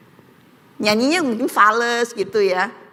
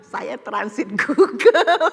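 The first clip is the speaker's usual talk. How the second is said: laughing slow reply; much slower than usual. Retold or imitated. Laughing retold joke answer.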